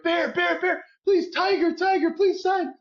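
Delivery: young son begging the players for autographs, mock pleading cry; kid begging. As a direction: beg like a kid desperate for an autograph.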